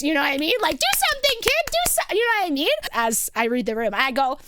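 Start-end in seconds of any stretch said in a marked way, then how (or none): 0.8-2.1 much higher, insistent egging-on; mock urging. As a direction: imitate a crowd demanding a performance. Voicing the staring crowd's demand.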